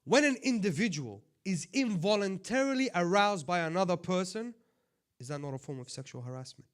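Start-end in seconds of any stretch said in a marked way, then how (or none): 0.0-4.5 loud, high, cutting in hard; sharp raised tone. Real, not acted. Preacher scolding the sisters in a raised, sharp voice. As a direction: raise your voice to demand attention. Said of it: "forgive me for using this tone".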